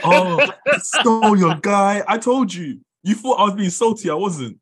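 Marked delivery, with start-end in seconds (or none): 0.0-2.0 slow teasing gloat; mock gloating. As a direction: taunt it slowly, laughing.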